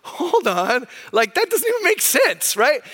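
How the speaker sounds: a pastor acting out Jesus calling out bad logic, high and incredulous; Jesus objecting. Pastor voices Jesus to the scribes, high incredulous objection. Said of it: "And Jesus goes".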